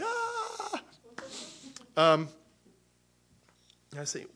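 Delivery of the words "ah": very high comic wail of weeping; mock crying wail. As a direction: imitate everyone bawling in a squeaky cry. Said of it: "we all cried".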